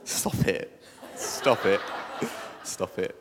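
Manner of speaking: breathy laughing protest. Real, not acted. Speaker reacting to the congregation's overdone whoa, laughing. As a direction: laugh it out, embarrassed and pleased.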